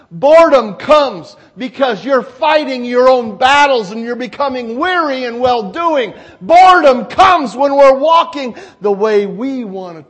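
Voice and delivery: loud, hammering repeated openings; forceful preaching. Real, not acted. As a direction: drive each cause home to the congregation.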